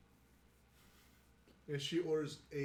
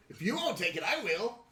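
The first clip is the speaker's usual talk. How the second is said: high, loud barfly butting in; raised, eager character voice; eager bar patron; 7 semitones higher, 6 dB louder than usual. Acted. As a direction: pipe up loudly to claim the drink. Guy at the bar butts in.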